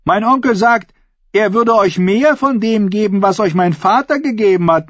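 high, projected speech to an assembly; sway the crowd with measured rhetorical questions; ringing, high-pitched declamation; bold woman's oratory. A narrator's reading.

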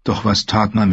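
ordinary delivery, nothing marked

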